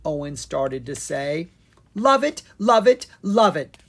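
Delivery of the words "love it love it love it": high, loud rapture; excited squealing. A narrator's reading. Pixie cutting in over her cake, squealing. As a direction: gush it over and over, loudly. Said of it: "Penelope Pepperpie continued".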